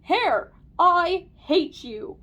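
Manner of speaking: loud, slow, drawn-out yell; man's yell. A narrator's reading. Man tells off the hair, yelling. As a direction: yell it with each word stretched. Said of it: "The man yelled".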